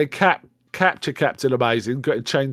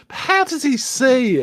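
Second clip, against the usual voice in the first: high-pitched incredulous query; mock bafflement; 11 semitones higher than usual. Friend retelling the glasses argument, mock bafflement. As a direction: imitate a baffled objection, pitched way up.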